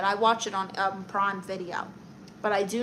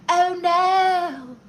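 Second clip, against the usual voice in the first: high, loud cartoon "oh no"; hammed-up high-pitched cartoon character voice; 8 semitones higher, 7 dB louder than usual. Streamer imitating a funny cartoon character, high mock dismay.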